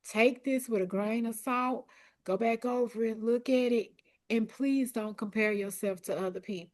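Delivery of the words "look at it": normal talking voice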